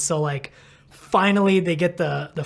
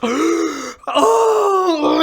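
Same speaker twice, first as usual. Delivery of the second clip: sudden loud high gasp of waking; imitated waking gasp; 11 semitones higher, 5 dB louder than usual. Retelling the injured rider coming to, loud startled gasp. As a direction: gasp awake with a cry. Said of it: "he just goes".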